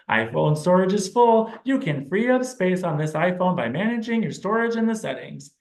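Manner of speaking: high, sing-song device announcement; sing-song phone alert. Retold or imitated. Client echoing a phone alert, in a sing-song voice. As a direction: lilt it like an iPhone alert. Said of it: "really rich intonation, sing-songy kind of voice".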